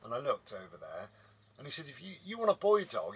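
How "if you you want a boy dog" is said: higher, louder caricature of a farmer; mock farmer. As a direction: quote the farmer in a put-on voice. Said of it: "he was a farmer".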